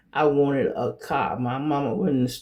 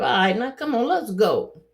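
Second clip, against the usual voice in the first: put-on higher, hurrying the kid out the door; impatient parent; 7 semitones higher than usual. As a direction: hurry her along, a bit higher. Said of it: "I'm doing her little paper".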